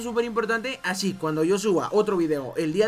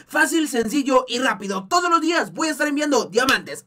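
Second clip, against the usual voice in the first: ordinary talk, nothing marked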